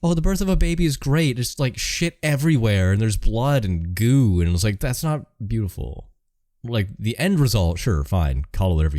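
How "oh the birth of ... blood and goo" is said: high, slow, sing-song mockery of baby-birth gushing; exaggerated sweet voice then drawn-out disgust; mocking gushing. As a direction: gush sarcastically like a sentimental fan. Mocking the sentimental birth view.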